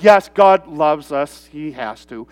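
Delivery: low, slow, resigned agreement, dropping away; grudging acceptance. Retold or imitated. People agreeing God must love them, grudging. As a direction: concede it wearily, voice sinking. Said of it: "Everyone kind of goes".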